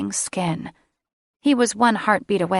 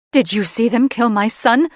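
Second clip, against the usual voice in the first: higher, louder urgent demand; 5 semitones higher, 6 dB louder than usual. Acted. Mother demanding answers from the headmistress, loud and high. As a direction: press her hard for the answer. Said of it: "Mother demanded".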